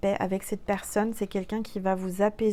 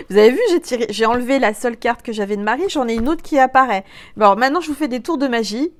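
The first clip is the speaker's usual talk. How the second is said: louder, fast, worked-up chatter; giddy excitement; excited; 2 semitones higher, 8 dB louder, faster than usual. Real, not acted. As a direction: rush it out in amazement. Excited about magic card trick.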